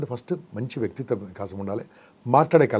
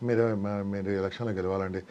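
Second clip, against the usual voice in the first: mumbled, soft and low with arms folded; lightly shown weak public speaking; timid speaker demo; 5 semitones lower, 9 dB quieter than usual. Shown as a demonstration. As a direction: play the nervous speaker who won't connect. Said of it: "ఇలా మాట్లాడుతున్నాను అనుకోండి".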